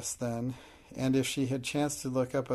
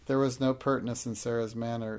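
usual speaking voice

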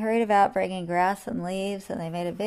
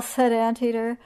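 plain reading voice

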